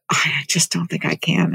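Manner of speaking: barely whispered, losing heart; whispered doubt. Retold or imitated. Student whispers her doubt to the doctor, hushed and defeated. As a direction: whisper it like she's already given up.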